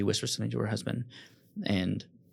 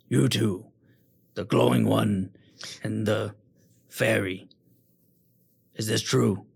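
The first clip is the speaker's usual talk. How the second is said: loud, measured demand; stern lord; 6 dB louder than usual. Acted. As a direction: weigh each word as you question them. Lord questions the party.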